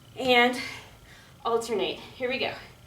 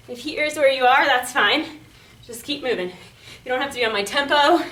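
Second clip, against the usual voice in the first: ordinary delivery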